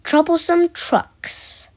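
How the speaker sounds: ordinary delivery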